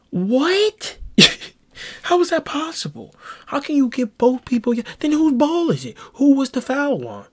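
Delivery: high-pitched, incredulous protest at the ref; outraged disbelief. Retold or imitated. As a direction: squeak out the disbelief at a baffling call. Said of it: "and I was like".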